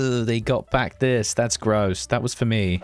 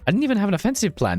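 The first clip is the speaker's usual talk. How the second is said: amazed; 11 semitones higher, faster than usual. Real, not acted. Amazed at own push.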